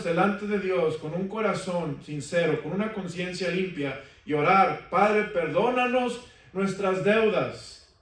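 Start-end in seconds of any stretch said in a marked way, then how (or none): 4.9-7.9 prayer voice. Acted. Quotes the Lord's prayer.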